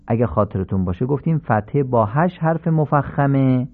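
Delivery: ordinary talk, nothing marked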